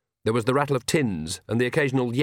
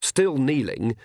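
plain reading voice

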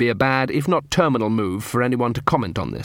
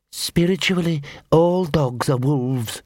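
slow, higher-pitched creature voice; fanatical poodle; 4 semitones higher, slower than usual. Acted. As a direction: intone it slowly like doctrine. Fanatical poodle recites doctrine.

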